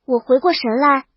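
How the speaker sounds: usual speaking voice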